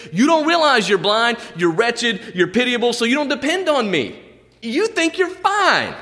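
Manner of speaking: high, insistent rebuke of the church; stern rebuke. Acted. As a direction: drive the rebuke home, high. Jesus rebukes Laodicea church.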